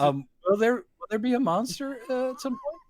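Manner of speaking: shy student with a hand up; timid question. Pretend. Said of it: "you're sitting in the back just like".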